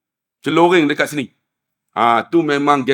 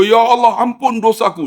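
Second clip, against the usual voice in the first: tearful plea; 6 semitones higher than usual. Retold or imitated. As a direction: imitate a tearful prayer. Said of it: "seorang menangis, semua menangis".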